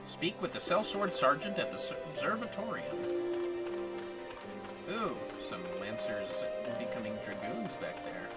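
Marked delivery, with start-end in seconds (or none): none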